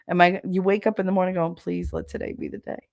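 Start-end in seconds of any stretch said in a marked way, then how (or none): 1.5-2.8 hushed, softly begging; quiet plea. Acted. Pregnant woman waking up hoping to deliver, quiet plea. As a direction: beg under your breath. Acts morning plea to give birth.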